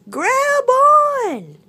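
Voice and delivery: high, loud rescuer's call. Acted. Sun bear lowering branch to animals, urgent call. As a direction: call down into the hole.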